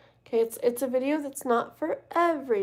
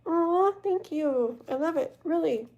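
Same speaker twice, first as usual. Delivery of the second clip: touched thanks; 6 semitones higher than usual. Retold or imitated. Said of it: "And I'm like".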